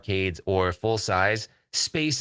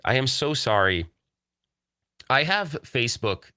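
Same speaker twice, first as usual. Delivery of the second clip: ordinary delivery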